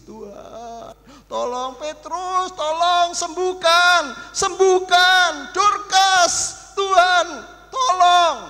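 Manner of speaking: high, loud, slow pleading cry; desperate widows' plea. Acted. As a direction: beg Peter to raise her. Widows begging Peter to heal Dorcas.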